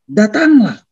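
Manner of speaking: lightly acted call to come. Jesus invites Peter onto the water, calling out.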